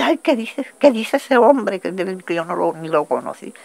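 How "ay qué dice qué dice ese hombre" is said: raised, high-pitched dismayed question; re-enacted alarm. Woman hearing of killings, re-enacted alarmed question. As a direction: ask it high, reliving the alarm. Retold alarmed reaction to the news.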